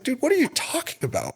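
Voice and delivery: what on earth are you on about; incredulous retort. Retold or imitated. Retelling how he answered the rude coach, incredulous and high.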